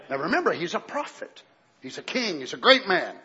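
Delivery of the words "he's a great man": ordinary delivery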